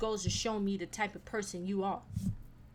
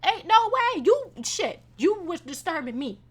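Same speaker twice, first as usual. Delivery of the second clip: high loud indignant retort; 9 semitones higher, 7 dB louder, slower than usual. Retold or imitated. Retelling her snap at the loud woman, indignant. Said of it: "I'm like".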